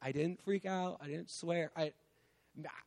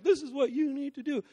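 higher, louder commanding preacher tone; bossy preacher voice; 8 semitones higher, 3 dB louder than usual. Retold or imitated. A preacher telling the crowd what they must do, commanding. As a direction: lay down the law like a lecturing preacher. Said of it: "it's not me like".

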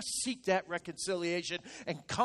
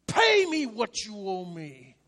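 slow, menacing demand at the throat; bombastic threat; 4 dB louder, slower than usual. Acted. Servant threatening his fellow servant, slow and menacing. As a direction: drag out each word like a thug choking a debtor. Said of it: "adding a little bombast to it".

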